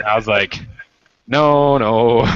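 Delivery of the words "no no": higher, louder panicky no; flustered refusal. Retold or imitated. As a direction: brush it off in a rush. Retold refusal, loud high.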